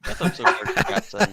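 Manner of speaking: high, laughing stage-Irish lilt; hammed-up Irish brogue through a laugh; mock Irish accent. Shown as a demonstration. Pundit asked to read a fan message in a mock Irish accent. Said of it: "say it in an Irish accent".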